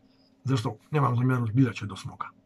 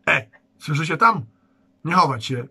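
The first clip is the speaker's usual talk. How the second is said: louder guard's hail across the huts; calling out; 4 semitones higher, 6 dB louder than usual. Acted. Guard calls to the boys hiding behind the hut, loud. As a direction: call them out of hiding.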